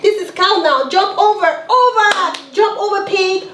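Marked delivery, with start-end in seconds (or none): none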